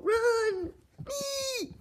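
high panicked shrieks; scared screams. Acted. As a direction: shriek and run. Fleeing ghost, screaming.

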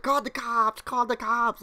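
high-pitched imitation of a panicked bystander; mock panicked cry. Clown's friend calls out during the fight, mock panicked cry.

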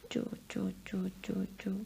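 soft unvoiced shush to a baby; shushing baby to sleep. Mother lulls her baby son to sleep, whispered shush.

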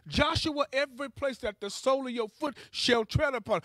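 usual speaking voice

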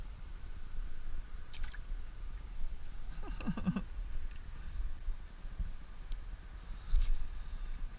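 soft airy laughter that goes on; long breathy laugh. Real, not acted. Angler laughing at his tiny catch, breathy laughter. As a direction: laugh quietly to yourself for a while. Laughing while releasing small fish.

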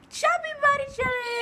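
high, loud title call; excited announcement. Acted. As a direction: hype up the challenge name.

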